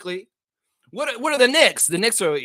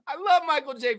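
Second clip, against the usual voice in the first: normal talking voice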